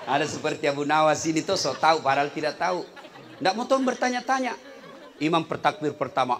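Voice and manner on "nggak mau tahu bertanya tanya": ordinary talk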